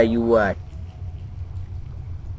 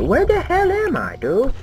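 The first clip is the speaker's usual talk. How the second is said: high lost cry; 14 semitones higher than usual. Real, not acted. Lost in the game.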